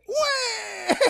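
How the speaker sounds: very high, long pulsing wail; hammed-up baby crying, held out; baby's wail. Singer imitates the sweet baby crying, hammed-up wail. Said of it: "My sweet baby said".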